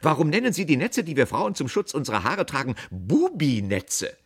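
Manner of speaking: loud, high, indignant interrogation; stern. A narrator's reading. Aunt challenging the apprentice, sternly indignant. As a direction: demand an explanation, drawing out the last word. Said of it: "fragte Tante Fanny streng".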